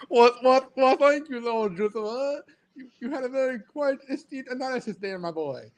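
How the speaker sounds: high, hammed-up British aristocrat accent; mock-aristocratic British accent with stretched words; mock lordly British accent. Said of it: "I can't even do that accent".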